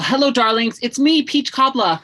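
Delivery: high, loud diva greeting; campy drag queen. Acted. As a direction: sweep in like a drag hostess. Drag host greets audience.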